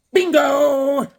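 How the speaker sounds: loud, high, stretched-out holler; big comic exclamation; triumphant shout. Pretend. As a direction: shout it like a winning call. Shouts punchline after a joke.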